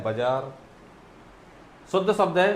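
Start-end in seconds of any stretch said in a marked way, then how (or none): 0.0-0.5 बाजार with a shortened first vowel, bə-zaar; spoken form. Shown as a demonstration. Teacher shows how people actually say it, short first vowel. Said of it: "बोलते क्या है".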